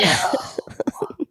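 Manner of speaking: put-on sharp whispered no in a child's face; hissed no. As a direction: lean in and hiss it. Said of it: "get in her face and was like".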